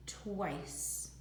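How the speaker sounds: plain reading voice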